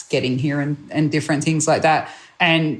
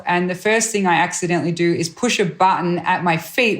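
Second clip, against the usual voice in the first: ordinary talk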